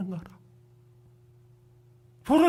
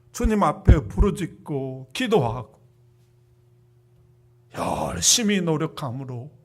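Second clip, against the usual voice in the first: loud fervent preaching prayer; 10 dB louder than usual. Acted. Pastor praying for congregation after sermon, fervent loud prayer. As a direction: pour out the prayer with rising force.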